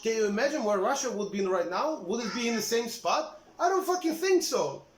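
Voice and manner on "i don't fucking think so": emphatic scoff. Real, not acted. Rant climax.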